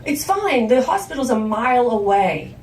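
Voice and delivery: loud, high, exasperated insistence; acted-out impatient daughter; insistent urging. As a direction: push them to get moving, loudly. Daughter urges mother to drive.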